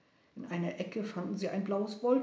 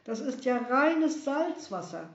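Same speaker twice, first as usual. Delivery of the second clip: disgusted exclamation; 5 semitones higher, 5 dB louder than usual. Acted. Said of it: "rief er".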